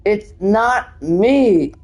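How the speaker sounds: hammed-up plea of innocence; acted protest. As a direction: stretch each word in theatrical pleading. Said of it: "And the next one says".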